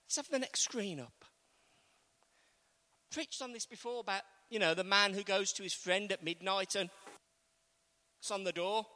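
ordinary talk

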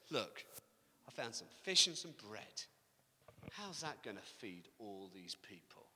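low, soft, hopeless; a quiet, defeated plea; despairing. Retold or imitated. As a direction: sink into despair. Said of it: "despair hits him".

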